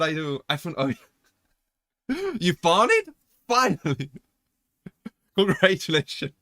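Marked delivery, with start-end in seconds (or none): 2.1-6.3 high-pitched, laughing congratulations; real giggles breaking through the words. Real, not acted. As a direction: break up laughing as you speak. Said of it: "I'm laughing because".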